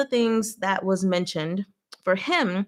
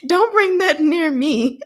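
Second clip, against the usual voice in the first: high, loud and slow, keep it away; exaggerated don't-touch-me whine; mock recoil; 7 semitones higher, 6 dB louder, slower than usual. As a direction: push the gym talk away, hammed up. Mock recoil from gym talk.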